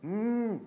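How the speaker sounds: हम्म hummed with all breath through the nose; nasal sound. Shown as a demonstration. Teacher showing air leaving only by the nose, hummed nasal हम्म. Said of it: "नासिक्य में पूरा प्रश्वास नाक से".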